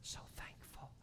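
slow, hushed whisper of gratitude; whispered thanks. Real, not acted. Preacher whispering his gratitude to the congregation. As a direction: breathe out heartfelt thanks. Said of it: "But I'm so thankful now".

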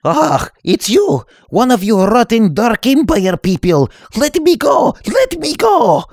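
high, loud terrified yelling; panicked shouting. Acted. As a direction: scream to be let go. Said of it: "Startled to be near a Sith Lord".

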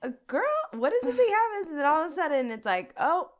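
high, loud, fast mocking rant; mock outrage. Friend mocking lawyers who sue, high loud mock rant. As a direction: ham up the greedy lawyers.

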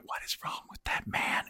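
hushed, whispered question; whispering so the stranger won't hear; child's whisper. Retold or imitated. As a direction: whisper it like a curious kid in a restroom. Child asks about man in stall.

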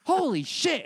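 high, slow, drawn-out yelp of disgust; a reporter recoiling live on air from a porta potty; mock news reporter shock. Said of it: "live on T V they go".

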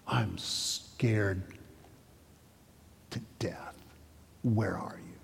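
soft, breathless whisper to God; hushed, frightened whisper of a prayer. Retold or imitated. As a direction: whisper it, scared and alone. Retelling the patient's honest prayer.

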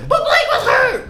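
mocking fan voice. Mocking a co-host's excuses in a high laughing voice. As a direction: squeal it through laughter like a fan making excuses. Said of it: "That is how I sound".

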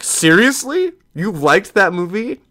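mock shocked friend, high and loud; incredulous friends. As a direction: pitch shoots way up in disbelief. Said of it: "And they're like".

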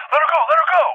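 high-pitched panicked demand; retold frantic plea. Parent to the elephant holding the daughter, acted frantic demand. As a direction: act out losing it, urgently. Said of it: "I'm losing my fucking shit".

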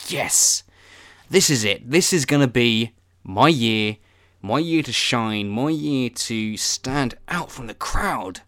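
loud, slow, drawn-out New Year optimism; mock triumphant. Hopeful person hyping the new year to himself, mock triumphant. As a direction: declare it with hammed-up hope and determination.

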